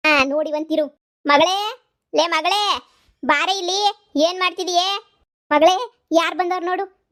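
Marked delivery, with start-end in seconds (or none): 1.3-7.1 loud high call to the daughter in the next room; mother calling her daughter from inside the house, loud and high. Acted. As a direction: shout for her daughter to come.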